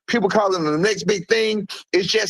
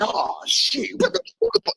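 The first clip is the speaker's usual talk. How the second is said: shocked reaction. Retold or imitated. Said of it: "everybody on this fucking live would be like".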